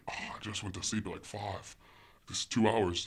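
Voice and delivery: soft, tired grumble; weary complaint. Retold or imitated. Parent woken after two hours' sleep, weary.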